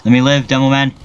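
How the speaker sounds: normal talking voice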